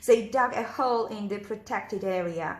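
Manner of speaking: plain reading voice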